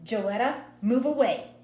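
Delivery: a hint of an eerie, stern mother; strange-voiced mother. A narrator's reading. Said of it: "Mama's voice still sounded strange".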